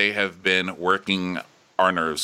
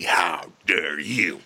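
mock outrage; much slower than usual.

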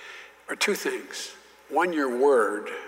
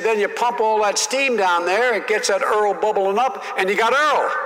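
higher, louder mock Southern senator; folksy senator explaining; 7 semitones higher, 4 dB louder than usual. Senator lecturing the young colleague on oil wells, hammed-up. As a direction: patiently explain like an old senator. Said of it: "And he said".

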